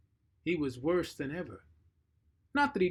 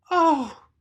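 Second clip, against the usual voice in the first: much higher, much louder oh; cry of dismay; 12 semitones higher, 13 dB louder than usual. Acted. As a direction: gasp it out, shocked. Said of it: "cried Anne".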